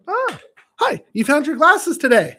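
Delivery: high bouncy teasing greeting; sing-song tease. Real, not acted. Repeats greeting louder, sing-song.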